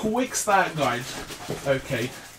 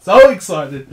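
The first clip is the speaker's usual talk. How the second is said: loud joyful outburst; burst of excitement; 9 dB louder than usual. Real, not acted. Vlogger bursting out to viewers, loud and joyful. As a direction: shout the excitement out. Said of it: "I'm so excited".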